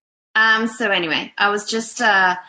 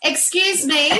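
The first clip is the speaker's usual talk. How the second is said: sharp mum reprimand, pitched up; 9 semitones higher than usual. Real, not acted. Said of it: "You can hear my mum voice right now".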